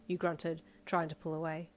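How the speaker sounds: plain reading voice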